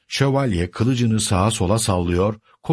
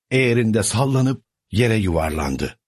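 ordinary delivery

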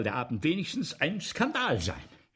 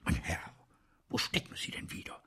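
stage manager whispering for the missing actor; urgent whisper; 4 dB quieter than usual. Acted. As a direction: whisper so the audience won't hear. Calling softly for missing actor.

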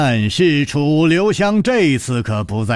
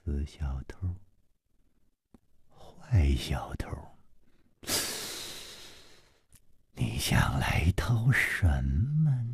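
soft slow whisper to himself; whispering to his reflection about the thief; mumbled whisper; 10 semitones lower, 12 dB quieter, slower than usual. Acted. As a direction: murmur it under your breath to the mirror. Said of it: "喃喃地说".

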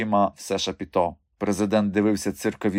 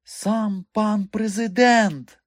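plain reading voice

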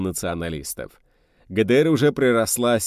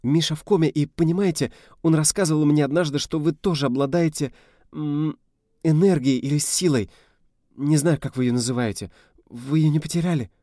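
put-on young woman pleading; 7 semitones higher than usual. A narrator's reading. Rita voiced pleading with man.

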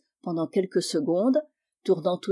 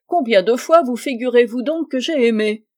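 raised, loud, indignant question; offended; 4 semitones higher, 7 dB louder than usual. A narrator's reading. Spinster protesting to the diplomat, offended. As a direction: protest loudly at the insinuation. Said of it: "s'écria la demoiselle offusquée".

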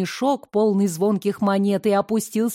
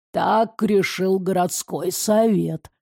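низкий, медленный, холодный чиновник; говорит басом, неторопливо, сухо; low slow official; 3 semitones lower, slower than usual. Acted. Council man answers coldly. (низкий медленный казённый голос)

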